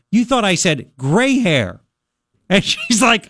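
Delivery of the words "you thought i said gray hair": "gray" set against "great"; stress on GRAY. Retold or imitated. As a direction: stretch "gray" to show the misheard word.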